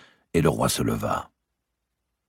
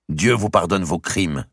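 slow, slightly raised, controlled rebuke; cold anger; 5 semitones higher, 4 dB louder than usual. A narrator's reading. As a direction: condemn her with contained anger. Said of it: "avec une colère froide".

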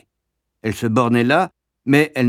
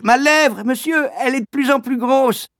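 put-on swollen-lip boy =149; 13 semitones higher than usual. Boy in pain.

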